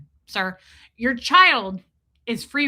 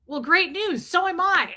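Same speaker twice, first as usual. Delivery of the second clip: sarcastic retort; 8 semitones higher than usual. Retold or imitated. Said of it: "I so badly wanted to say back".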